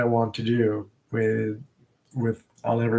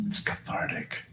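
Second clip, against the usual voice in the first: soft whisper; 5 dB quieter than usual. Acted. To the viewers, savoring a favorite word, whispered. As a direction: breathe it out in a whisper.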